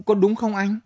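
high playful question; teasing question. Acted. Wife teasing soldier about falling pomelo, high and playful. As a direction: tease him with a lilt.

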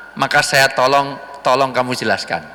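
ordinary delivery

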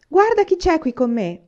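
high, loud call to the wife; excited shout. A narrator's reading. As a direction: call out big news from the doorway. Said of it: "gridò".

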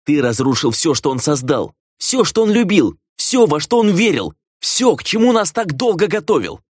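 high, insistent, mounting charges; each phrase climbing in indignation; furious accusation. A narrator's reading. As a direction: hammer out the list of his crimes. Sister's furious accusation in the quarrel.

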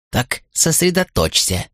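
high, slow, gruffly impatient order; rude command. A narrator's reading. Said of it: "грубо заявила Кетл". (грубый приказ)

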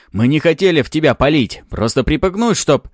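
high, loud blurted defence; agitated excuse. A narrator's reading. Second cop blurting excuse to hero, agitated. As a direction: plead it out and break off.

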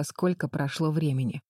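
usual speaking voice

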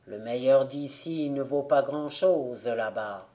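a dead man letting go of a grudge; forgiving ghost. Acted.

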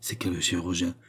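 ordinary delivery

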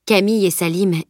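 ordinary delivery

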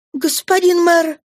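a sudden high cry for help; desperate cry. A narrator's reading. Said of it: "крикнула Фантина".

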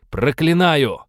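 loud high battle cry; shouted curse. A narrator's reading. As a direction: hurl the curse with force.

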